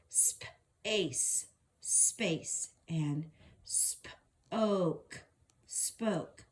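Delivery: "space" and "spoke" half whispered, stretched; sounding out. Shown as a demonstration. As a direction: whisper the long vowels. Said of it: "It's gonna say its own name".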